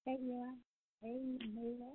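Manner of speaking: muffled, distant bad phone line; faint caller on a bad Bluetooth line; in a tunnel. Real, not acted. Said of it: "sounds like you're in a tunnel".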